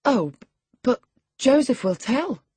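nervous stammer. Acted. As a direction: falter on the word, voice pitched high. Catherine nervously objects, stammering.

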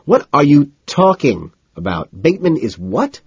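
wired disbelief. A narrator's reading. Narrator questions Carnes about Bateman, wired and incredulous. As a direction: demand it, pitch shooting up. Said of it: "feeling wired".

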